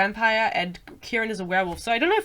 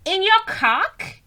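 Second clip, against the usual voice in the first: high-pitched, drawn-out incredulous question; 10 semitones higher, 4 dB louder, much slower than usual. Acted. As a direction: put on a high girly voice, slowly asking. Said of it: "I think she talks like that".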